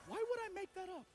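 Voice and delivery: high-pitched mock indignation; incredulous, pitched right up; indignant disbelief. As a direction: protest it high, as if insulted. Acted indignant thought at the nurse.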